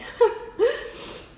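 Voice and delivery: breathy laugh catching into a sob; teary laugh. Real, not acted. Fighting tears before her story, a choked laugh. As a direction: laugh to hold back the tears. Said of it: "I'm not gonna cry".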